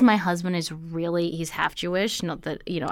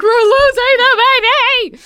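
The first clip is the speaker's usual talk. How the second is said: much higher, louder alarm; panicked cry; 12 dB louder than usual. Retold or imitated.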